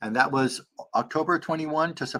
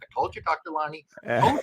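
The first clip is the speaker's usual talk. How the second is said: mock told-you-so; 6 semitones higher than usual. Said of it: "she's like".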